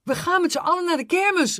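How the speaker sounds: high, loud, fast call; excited child calling out. Acted. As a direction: shout it out eagerly. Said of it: "riep Jackie".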